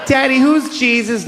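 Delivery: high, slow imitation of a kid; put-on little-kid voice, drawn out; child's voice. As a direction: mimic an innocent child asking daddy. Voices his child asking a question.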